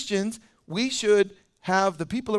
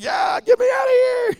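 much higher, louder comic panic; mock panicked shout; 8 dB louder than usual. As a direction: beg to escape the stink, hammed-up. Fleeing smelly nursery.

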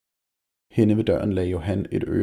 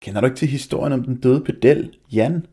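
mocking; 5 semitones higher, 4 dB louder than usual. A narrator's reading. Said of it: "grinede Malte hånligt".

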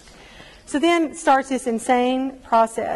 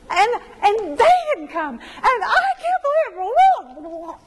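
exasperated laugh; 10 semitones higher, 4 dB louder, slower than usual. Real, not acted. Reliving the hurt of no-shows.

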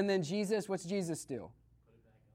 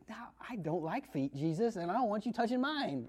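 squeamish Peter; 2 semitones higher, 7 dB quieter than usual. Retold or imitated.

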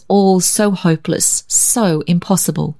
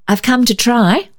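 brightly; 6 semitones higher than usual. A narrator's reading. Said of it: "she amended brightly".

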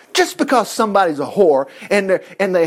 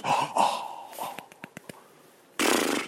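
breathy whispered oh, oh, oh; mock sighs; 12 dB quieter, much slower than usual. Preacher mimicking the church crowd sighing with pity, whispered. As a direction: sigh like pitying church folk.

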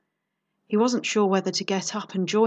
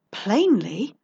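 rising, teasing echo of "Plainly"; amused echo; 2 semitones higher, 4 dB louder than usual. Acted. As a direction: toss "Plainly" back as a question. Detective echoes the count's word.

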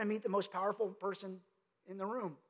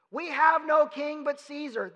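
"We have no king but Caesar" slow, higher and louder, stress on "have"; crowd's retort; 5 semitones higher, 6 dB louder, slower than usual. Retold or imitated. Crowd answering Pilate, slow defiant mock declaration.

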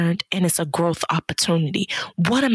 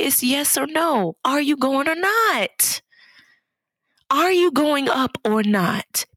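emphatic challenge; 8 semitones higher, slower than usual. Acted. Preacher pushes listener to decide.

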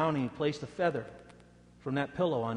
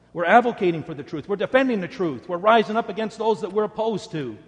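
mock indignation swelling, much higher and louder; mock crusader rising into a loud rant; self-righteous rant, building; 7 semitones higher, 11 dB louder than usual. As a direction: imitate gossipers working themselves up. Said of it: "Justifying it by saying".

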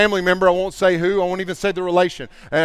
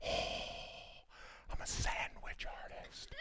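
breathy whisper, much softer; mock mumbling worker; 22 dB quieter than usual. Bored sandwich worker answering a customer, whispered. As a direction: shrink the words into a sheepish hush.

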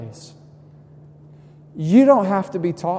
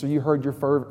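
ordinary delivery

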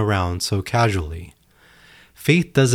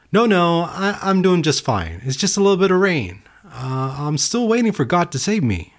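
stubborn nervous man; 9 semitones higher than usual. Acted. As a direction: play the stubborn man, pitched up and wavering in denial. Stubborn man refusing rescue, nervous.

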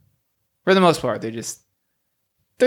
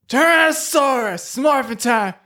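proper morph call; 9 semitones higher than usual. Acted. Gerald finally doing the morph call right, heroic yell. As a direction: shout it like a real Ranger. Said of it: "then yell the dinosaur name".

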